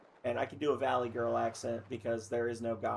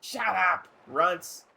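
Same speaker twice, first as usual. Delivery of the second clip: loud, higher-pitched put-down; acted angry outburst; 6 semitones higher, 6 dB louder, much slower than usual. Dolphin silencing the smaller characters, angry. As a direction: silence them with a loud rebuke. Dolphin snapping back in quarrel.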